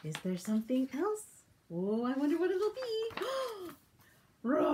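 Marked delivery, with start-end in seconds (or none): 4.4-4.7 big lion call; lion roar. Acted. The little lion roars at the animals. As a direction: growl a roar.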